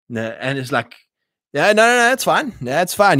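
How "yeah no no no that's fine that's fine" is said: high, breezy brush-off, much higher than usual; dismissive mock dad. Dad waving off his son about the ruined tractor, high mock-cheery brush-off.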